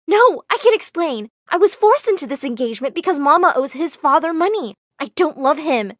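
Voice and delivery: high, pleading explanation; urgent protest. Acted. Amara explaining the forced engagement to Gavin, urgent pleading. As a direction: insist desperately on being believed.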